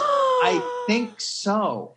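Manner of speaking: very high, stretched-out reply; imitating a knowing, teasing producer; drawn-out coy answer. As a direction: draw it out slowly in a sing-song high pitch.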